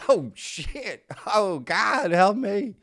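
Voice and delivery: high, loud, frantic cry for help; mock panicked scream. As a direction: yell it like someone terrified being abducted. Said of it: "most people would be screaming".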